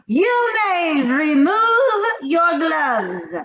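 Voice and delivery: high, slow, loud grating command; shouting witch. A narrator's reading. Grand High Witch commands the seated witches, slow high shout. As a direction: drag out the order like a tyrant witch. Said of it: "she shouted".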